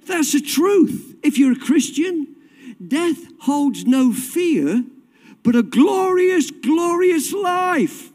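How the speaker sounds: high, ringing sermon delivery; impassioned preaching. Real, not acted. Preacher telling the church heaven is glorious, impassioned preaching. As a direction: build a driving litany of repeated phrases, pitched high.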